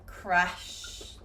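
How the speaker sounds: "crash" said clearly, ending on a long sh; demo word. Shown as a demonstration. Teacher models "crash" for learners, clearly. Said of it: "if I give you another word".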